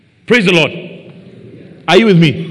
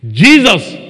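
higher, louder desperate call; crying out; 6 semitones higher, 7 dB louder than usual. A narrator's reading. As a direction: call out his name as if begging. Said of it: "he began to cry out and say".

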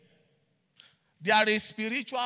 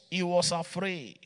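ordinary talk, nothing marked

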